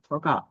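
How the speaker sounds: forGOT, weight on the GOT; stress on second syllable. Shown as a demonstration. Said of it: "The stress follows on the second".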